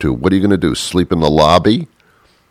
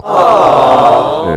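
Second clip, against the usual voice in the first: aw; 8 semitones higher, 4 dB louder than usual. Real, not acted. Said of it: "cooing aloud".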